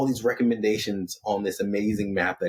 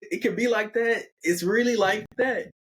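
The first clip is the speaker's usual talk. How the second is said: high-pitched, awestruck disbelief; floored and wowed, pitched way up; overwhelmed amazement; 12 semitones higher than usual. Acted. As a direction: marvel at it in a raised, acted voice. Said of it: "that really breaks Jonas".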